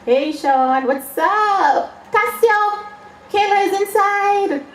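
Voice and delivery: high-pitched, sing-out holler; calling out greetings. Pretend. Poet greets Sean and Casio, calling out. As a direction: call across to friends, voice leaping up high. Calls out greetings to named people.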